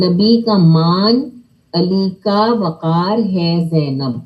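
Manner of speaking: slow, measured line with long مان; slow model recitation. Shown as a demonstration. Teacher reciting for children, slow and measured. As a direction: read it slowly, stretching the long vowels. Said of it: "اسے کھینچ کے اونچا کرنا ہے".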